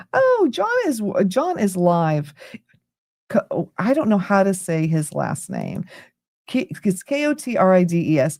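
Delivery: ordinary delivery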